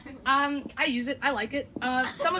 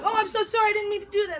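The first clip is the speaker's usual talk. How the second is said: fake apology; 8 semitones higher, 3 dB louder, faster than usual. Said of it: "and be like".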